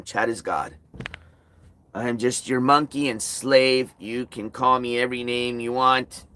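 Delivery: low, soft, sarcastic self-abasement; mock grovelling. Streamer sarcastically surrendering to his chat, mock meek. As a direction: sulk it out like a beaten servant.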